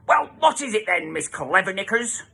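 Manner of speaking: high, mocking put-down; sneering dinner lady. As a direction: sneer at the clever-clogs girl.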